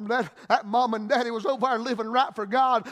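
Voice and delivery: normal talking voice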